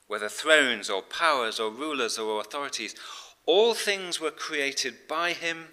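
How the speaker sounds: emphatic, loud, measured proclamation; scripture reading. Acted. Preacher reads verses to the congregation, emphatic scripture reading. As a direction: proclaim the verses with weight on key phrases.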